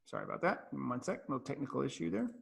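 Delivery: far from the mic, muttering to self; quiet off-mic aside while searching for slides. Real, not acted. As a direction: drift away from the microphone and talk under your breath.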